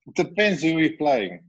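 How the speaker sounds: ordinary delivery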